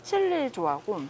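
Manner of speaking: chewing while talking; speaking between bites; mouth full of food. Real, not acted. Seen 2: Fork in hand, cheeks bulge, chewing throughout; meat type unverifiable.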